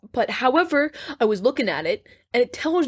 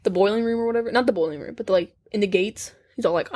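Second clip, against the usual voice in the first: ordinary talk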